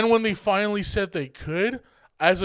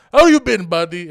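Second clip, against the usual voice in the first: exaggerated Middle Eastern accent; 2 semitones higher, 10 dB louder than usual. Imitating an old customer greeting him, loud exaggerated Middle Eastern accent. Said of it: "accentuates his accent".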